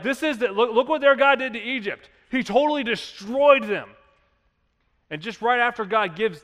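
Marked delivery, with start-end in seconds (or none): 0.0-3.9 higher, louder, frightened retelling; mock fearful townsfolk. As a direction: play the scared neighbours, pitched up. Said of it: "They'd be like".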